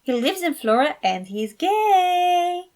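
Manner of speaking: high, loud, slow meaningful hint; pointed teasing. A narrator's reading. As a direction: lay it on thick to tease your uncle. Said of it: "not too subtle".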